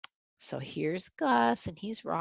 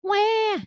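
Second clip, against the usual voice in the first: put-on baby wail; 6 dB louder than usual. Baby ghost crying.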